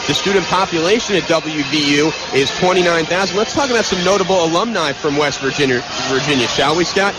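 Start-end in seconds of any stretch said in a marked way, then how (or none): none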